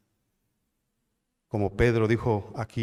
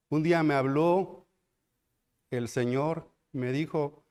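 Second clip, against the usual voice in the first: ordinary talk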